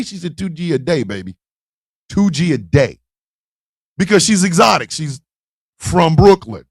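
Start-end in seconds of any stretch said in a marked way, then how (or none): 0.4-3.0 low, falling, self-assured reply; cool confirmation. Retold or imitated. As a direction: confirm it coolly, dropping in pitch. Woman confirms it coolly.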